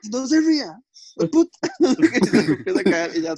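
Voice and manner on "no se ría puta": high-pitched imitation of an angry mom; mock scolding mom. Mom to son holding in laughter, mock scolding. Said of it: "aguantándome la ganas de reírme".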